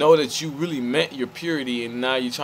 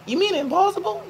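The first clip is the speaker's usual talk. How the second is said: very high-pitched mock question; squeaky-high imitation of someone questioning; imagined questioner; faster than usual. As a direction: put on a high voice, querying the word.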